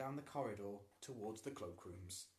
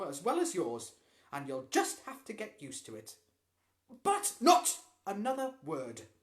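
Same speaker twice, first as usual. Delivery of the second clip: stern scolding; 6 semitones higher, 12 dB louder than usual. A narrator's reading. Mother scolding son.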